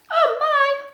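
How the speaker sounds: high, loud startled gasp; startled little owl. Acted. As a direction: jump out of your feathers. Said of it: "thought Ula".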